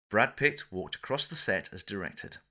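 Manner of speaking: plain reading voice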